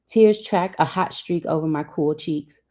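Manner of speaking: ordinary delivery, nothing marked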